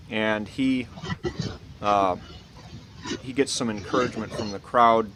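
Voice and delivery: usual speaking voice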